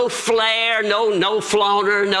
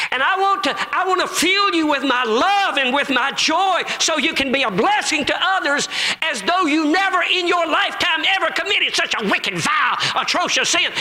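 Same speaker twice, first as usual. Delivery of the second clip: high-pitched, impassioned proclamation; fervent preaching; 9 semitones higher than usual. Acted. The Lord promises love and joy, fervently preached.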